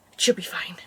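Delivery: hushed whisper to herself. Real, not acted. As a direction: breathe it out, willing it true. Worried reassurance to herself.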